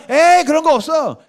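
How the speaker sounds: high-pitched mock scoff; dismissive scoffing. Preacher mimics the world denying God's kingdom, dismissive scoffing.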